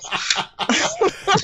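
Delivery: giggle. Real, not acted. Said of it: "laughing like a little girl".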